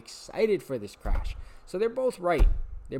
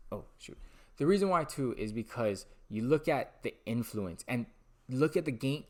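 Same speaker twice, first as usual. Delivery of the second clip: normal talking voice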